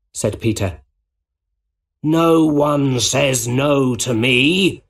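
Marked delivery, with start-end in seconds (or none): 2.0-4.8 slow, raised villain shout. A narrator's reading. Green Goblin refused by the hero, shouting. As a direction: draw it out as a defiant yell. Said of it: "shouted the Green Goblin".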